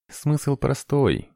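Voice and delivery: slow drawn-out words. A narrator's reading. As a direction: stretch each word lazily. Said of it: "протянул Тавита".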